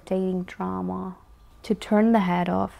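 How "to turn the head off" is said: ordinary talk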